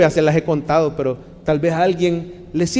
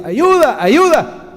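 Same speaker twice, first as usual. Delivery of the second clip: call for help; 8 semitones higher, 3 dB louder, much slower than usual. Retold or imitated. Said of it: "le digo".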